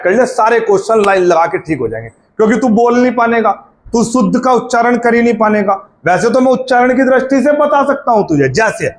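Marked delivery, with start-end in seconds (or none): none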